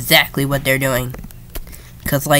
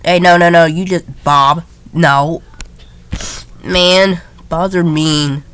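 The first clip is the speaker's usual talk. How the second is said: ordinary delivery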